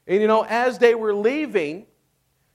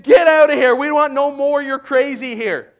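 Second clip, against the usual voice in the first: loud, high, fed-up dismissal; fed-up Pharaoh; 6 semitones higher, 8 dB louder than usual. Retold or imitated. Pharaoh throwing the Israelites out, loud exasperated shout. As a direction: throw them out, done with it all. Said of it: "Basically, he said".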